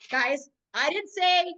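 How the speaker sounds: ordinary talk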